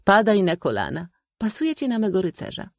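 high-pitched, playfully commanding spinster; coquettish old maid. A narrator's reading. Old maid choosing a young man as her knight, coquettish.

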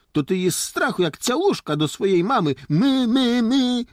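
high, loud bleating taunt; mock frightened calf. Boy mocks his scared friend as a calf, jeering. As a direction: tease with a drawn-up, high calf's cry. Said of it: "Jak kozak krzyknął".

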